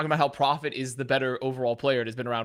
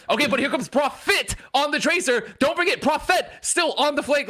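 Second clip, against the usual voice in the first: high excited caster call; mock caster call; 9 semitones higher than usual. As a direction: rush the names like a caster. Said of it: "everyone's like".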